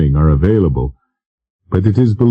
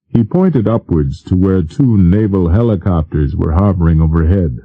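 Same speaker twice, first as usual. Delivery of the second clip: plain reading voice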